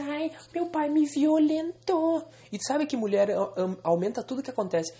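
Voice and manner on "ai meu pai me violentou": high, slow, whiny fake complaint; louder, high, drawn-out mock whine; mock whiny accusation. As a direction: drag it out like a hammed-up victim. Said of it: "Se ela fosse na polícia".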